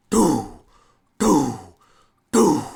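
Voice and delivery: three loud, high, falling doo blasts; loud booming "doo". Retold or imitated. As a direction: boom out each doo, well apart. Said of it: "there is a loud".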